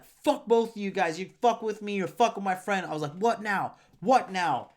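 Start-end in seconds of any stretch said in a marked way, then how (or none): 0.0-2.9 high-pitched angry outburst, retold; worked-up, confrontational rant; going ape shit. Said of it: "going full ape shit on them". 3.1-4.7 high, goading challenge; re-enacted riled-up dare; going ape shit. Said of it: "going full ape shit on them".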